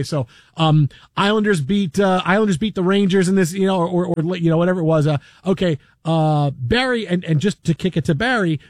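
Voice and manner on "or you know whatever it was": ordinary talk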